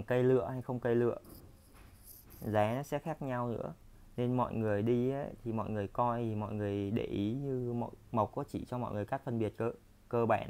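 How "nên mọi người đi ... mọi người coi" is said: usual speaking voice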